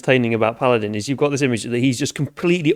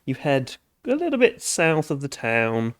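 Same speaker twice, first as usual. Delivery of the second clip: usual speaking voice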